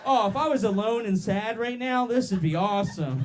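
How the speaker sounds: high, loud, gushing outburst; mock ecstatic. Comedian acting out absurd bedroom thoughts, mock ecstatic. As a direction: play exaggerated bedroom delight. Mock exclamation during imagined sex.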